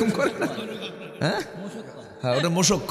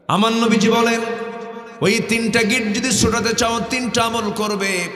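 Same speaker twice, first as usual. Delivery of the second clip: full-force preaching; 7 semitones higher, 5 dB louder than usual. Acted. Preacher voicing God's call to the congregation, fervent. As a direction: preach it out with fervour.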